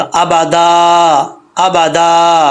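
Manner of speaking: अबादा ending on a long held आ, no n; alif at pause. Shown as a demonstration. Teacher shows stopping on alif, long final vowel. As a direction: draw out the last vowel of अबादा. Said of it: "अलिफ पढ़ा जाएगा".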